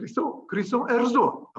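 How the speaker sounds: hammed-up Italian accent. Shown as a demonstration. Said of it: "so in my Italian accent".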